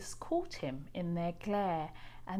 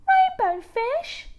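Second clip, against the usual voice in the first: high, loud, slow call after him; little fish calling out to catch up; 10 dB louder, slower than usual. A narrator's reading. Little blue fish calls after rainbow fish, loud and high. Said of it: "he called".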